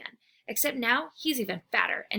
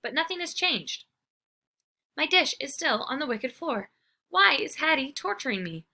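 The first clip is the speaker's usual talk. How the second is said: ordinary delivery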